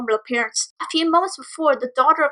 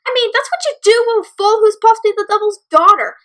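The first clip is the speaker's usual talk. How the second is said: higher, sneering jab; mocking; 9 semitones higher, 4 dB louder than usual. Bully's mom sneering at the filly, a high mocking jab. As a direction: sneer to insult the girl. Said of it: "the bully's mom said with a mocking voice".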